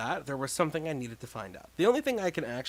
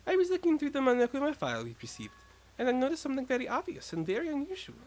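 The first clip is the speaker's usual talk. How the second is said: put-on higher, knowing girl; a lightly voiced sly reveal; smug character voice; 12 semitones higher than usual.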